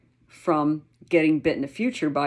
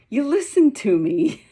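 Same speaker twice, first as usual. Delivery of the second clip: high, loud burst of doggy joy; excited dog finally being heard; happy dog voice; 10 semitones higher, 6 dB louder than usual. Acted. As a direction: gush like a thrilled dog. Dog's happy thoughts voiced.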